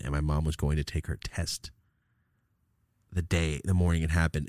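ordinary talk

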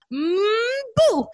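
lightly imitated toddler peekaboo; big sister playing peekaboo with baby brother; playful peekaboo. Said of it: "she's doing this".

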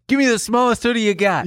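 high, pushy customer voice; quoted demand. Retold or imitated. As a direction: blurt it like a desperate shopper. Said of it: "I went to the store and said".

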